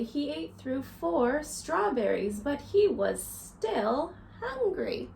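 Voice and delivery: lively storybook read-aloud for little ones; reading to children. A narrator's reading. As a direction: read it to a circle of kids, counting along. Reads picture book to children.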